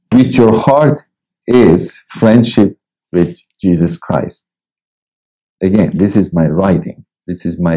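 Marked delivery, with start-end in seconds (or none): 0.1-4.5 slow, deliberate reading from his book; devotional reading. Acted. Preacher reads his devotional book to listeners, slow and measured. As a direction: read each line slowly and let it land.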